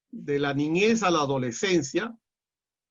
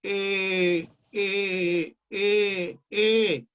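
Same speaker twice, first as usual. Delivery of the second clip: stuck hesitating; 2 semitones higher, much slower than usual. Retold or imitated. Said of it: "él se quedaba en".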